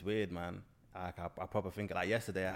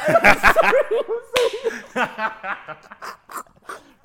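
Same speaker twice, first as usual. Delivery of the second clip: long, very high, loud laugh; helpless laughter; 18 dB louder than usual. Real, not acted. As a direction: burst into loud, high-pitched cackling. Bursts out laughing over the film.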